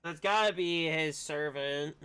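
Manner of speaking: ordinary delivery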